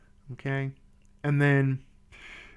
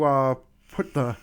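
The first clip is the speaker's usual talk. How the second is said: pen in teeth. Real, not acted. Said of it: "sorry, I have my pen in my mouth".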